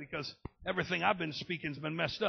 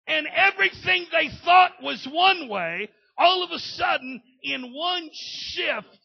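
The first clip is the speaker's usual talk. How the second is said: shouted, higher, fiery preaching; preaching at full force; 9 semitones higher, 14 dB louder, slower than usual. Acted. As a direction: lift the church off its seats. Preacher erupts in excitement.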